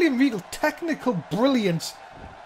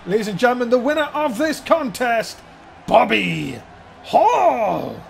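ring announcer; 2 semitones lower, 4 dB louder than usual. Acted.

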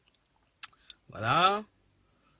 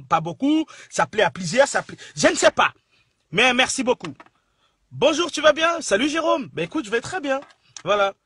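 excited; 4 semitones higher, 8 dB louder than usual. Real, not acted. Thrilled by compliment on accent.

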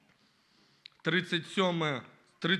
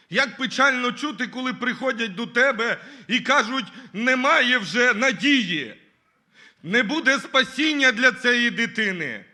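rising, louder sorrowful preaching; impassioned preaching; 3 semitones higher, 4 dB louder than usual. Acted. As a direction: plead with the church about grieving fathers. Impassioned lament in sermon. (схвильована проповідь про скорботу)

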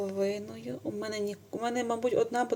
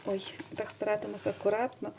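ordinary delivery

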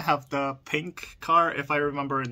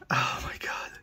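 whispering; 3 dB quieter than usual. Real, not acted. Marvels at the car.

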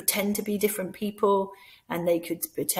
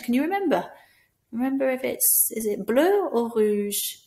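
higher, slow bleu or rouge; slow choice question; 4 semitones higher than usual. Shown as a demonstration. Teacher offering a child two French colours. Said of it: "revise the colours".

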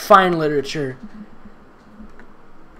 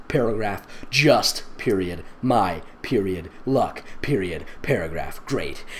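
deadpan; 7 semitones lower, 6 dB quieter than usual. Acted. Reading punctuation aloud flatly.

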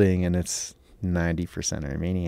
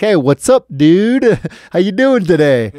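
much louder, high-pitched exaggerated hello; loud repeated greeting; 10 semitones higher, 12 dB louder, slower than usual. Repeating hello to a silent neighbor, exaggeratedly loud. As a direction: belt it out so he can't miss it. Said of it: "said that kinda quiet or slurred".

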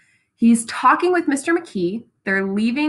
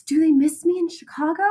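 higher-pitched character question; hint of Daisy; 6 semitones higher than usual. Daisy asks Nick about Chicago, lightly voiced higher.

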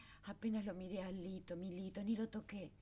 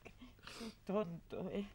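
relieved; 5 dB quieter, much slower than usual. Acted. Relief after scare, slow.

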